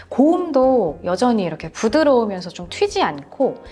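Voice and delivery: usual speaking voice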